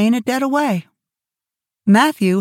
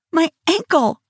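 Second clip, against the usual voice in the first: gasped; 8 semitones higher than usual. A narrator's reading. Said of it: "gasped Anne".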